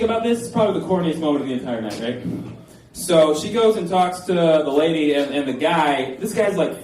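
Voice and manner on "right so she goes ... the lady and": ordinary talk, nothing marked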